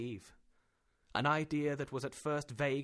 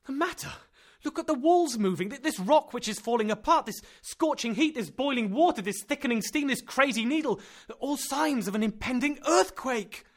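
high and loud, each sign flung out; frantic, pleading alarm; panicked list; 11 semitones higher, 9 dB louder than usual. Acted. Axel lists frightening signs.

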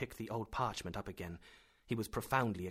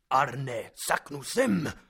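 loud, slow triumphant cry; 4 semitones higher, 10 dB louder, much slower than usual. Acted. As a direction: crow the name out in triumph. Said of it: "he cried in a triumphant voice".

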